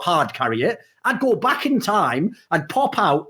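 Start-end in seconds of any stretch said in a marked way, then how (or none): none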